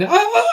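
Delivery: sharp high yelp, imitating the little guy; mock scream. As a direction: let out a short shriek as if clinging to the bag. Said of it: "And he started screaming".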